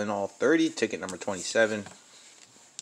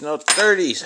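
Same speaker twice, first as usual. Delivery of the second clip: higher and louder, fed up; exasperated louder repeat; frustrated outburst; 5 semitones higher, 8 dB louder, slower than usual. Real, not acted. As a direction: blurt it out in frustration at the ticket. Frustrated at losing numbers.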